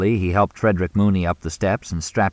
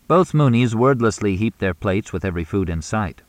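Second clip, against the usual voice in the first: usual speaking voice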